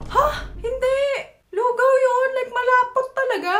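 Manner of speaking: high, loud, whiny insistence; mock outraged shout. Friend insisting goto is thick porridge, hammed-up shout. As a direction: shriek it like a petty fight.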